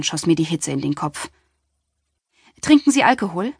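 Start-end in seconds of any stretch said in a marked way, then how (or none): 2.6-3.5 blurted quickly, higher and louder; hasty blurt. A narrator's reading. The flustered doctor blurts the question to cover an awkward moment. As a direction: rush the question out to cover embarrassment. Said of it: "viel zu hastig ein".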